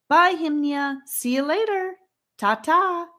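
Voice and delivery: high, loud farewell call. A narrator's reading. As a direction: call goodbye across a distance. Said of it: "they called".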